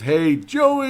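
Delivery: a lighter imitation of his shout; imitating a greeting shout. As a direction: hail him like a buddy across the room. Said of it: "I try to imitate it fairly well without saying it as loud".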